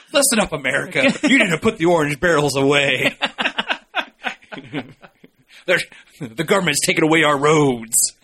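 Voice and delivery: laughing mock pundit rant; hammy outraged talk radio voice; conservative radio host. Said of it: "Sound like a conservative radio host".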